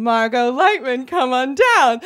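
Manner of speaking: slow, drawn-out contestant call; game show announcer. Retold or imitated. Announcer calling the next contestant from the audience, showman style. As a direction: announce the name with showman's relish.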